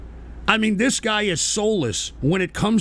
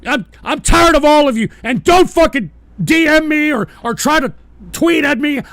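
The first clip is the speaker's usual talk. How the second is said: high, loud, fed-up tirade; furious shouting; 8 semitones higher, 9 dB louder than usual. Real, not acted. Streamer tired of all his chatters, shouting angrily. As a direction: yell at them, out of patience. Host rails at banned chatters.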